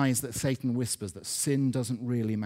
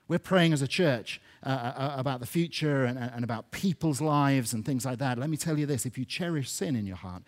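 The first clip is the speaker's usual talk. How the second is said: usual speaking voice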